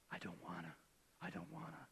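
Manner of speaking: very soft, near-whispered reluctance; hushed reluctance. Acted. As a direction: say it barely aloud, as a private admission. Voicing Jesus's tempted reluctance.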